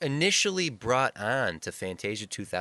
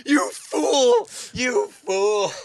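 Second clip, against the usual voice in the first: high, loud, laughing scold; scolding the artist in his head with a laugh; mock inner rant; 12 semitones higher, 5 dB louder, much slower than usual. Speaker in back row mocks the artist inside his head, high laughing scold. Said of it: "in my head, I'm going".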